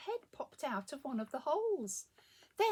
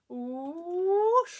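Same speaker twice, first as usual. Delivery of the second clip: loud whoosh sweeping upward; rocket whoosh; 6 semitones higher, 12 dB louder than usual. A narrator's reading. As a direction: launch it with a rising rush. Rocket takes off.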